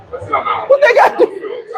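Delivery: high laughing squeal, slowed; laughing disbelief. Real, not acted.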